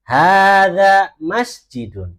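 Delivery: slow, loud, drawn-out Arabic, every syllable of "Hada masjidun" stretched; slow model reading. Shown as a demonstration. Teacher modelling Arabic for the class, slow loud recitation. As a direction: chant it slowly for the class to repeat. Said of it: "kita baca bersama-sama".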